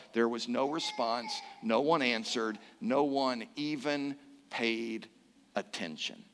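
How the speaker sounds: low, soft, trailing into near silence; hushed, solemn. A narrator's reading. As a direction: let each phrase sink into emptiness. Preacher sums up the empty silence.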